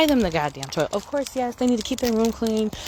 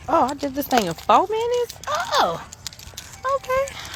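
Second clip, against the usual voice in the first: surprised; 14 semitones higher than usual. Real, not acted. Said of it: "surprised at the time".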